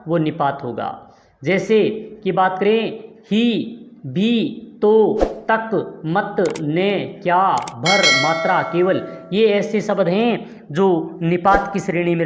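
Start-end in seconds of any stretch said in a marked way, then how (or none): 3.3-9.1 each particle drawn out slowly; slow listing. Shown as a demonstration. Teacher listing particles to the class, very slowly. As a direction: spell out ही, भी, तो, तक one by one, slowly. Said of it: "विशेष बल दिया जाएगा".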